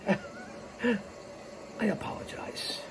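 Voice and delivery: breathy laughing apology; whispered laugh. Real, not acted.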